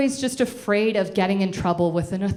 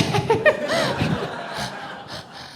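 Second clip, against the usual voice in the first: high laugh. Real, not acted. Laughing at mother in audience.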